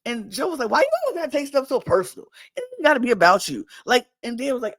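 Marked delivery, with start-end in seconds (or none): 0.7-3.7 high, rapid bickering; mock exasperation. Joe snapping at Dan, mock exasperated. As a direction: fire back fast and high, imitating an argument.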